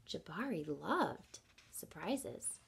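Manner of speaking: slow, soft whisper; slow hushed secret whisper. A narrator's reading. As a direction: share it like a secret. Whispered narration.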